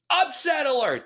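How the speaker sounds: shrill yell through a backwards megaphone; squeaky, tinny upset alert shout; toy megaphone. Retold or imitated. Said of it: "he's yelling into the wrong side of the megaphone".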